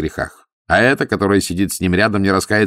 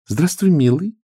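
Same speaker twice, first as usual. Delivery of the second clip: cooing femme fatale; 8 semitones higher than usual. Acted. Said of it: "проворковала Мария".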